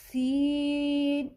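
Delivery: سین with the vowel stretched long; lengthened letter. Shown as a demonstration. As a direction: draw out سین for two and a half counts. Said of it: "ناک میں آواز ہرگز، ہرگز نہیں".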